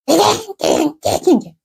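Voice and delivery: high, repeated dog yelps; storyteller's yelping dog noise; imitated dog yelping. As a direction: mimic a dog yelping over and over. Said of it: "개가 짖었어요".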